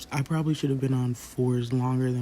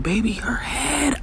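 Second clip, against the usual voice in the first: hushed awe; 10 semitones higher than usual. Retold or imitated. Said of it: "he was like".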